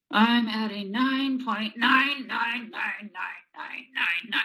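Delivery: drawn-out, emphatic exaggeration in a kid's answer; lightly acted angry kid. Child answers the teacher's rating question, mildly hammed-up exasperation. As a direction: stretch it out like a fed-up kid overdoing the rating.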